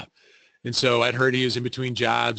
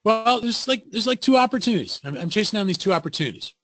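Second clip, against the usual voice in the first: high, hesitant mock-upbeat reply; imitating an unsure friend; 8 semitones higher than usual. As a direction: play the job hunter faking confidence. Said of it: "Ken's version of it was".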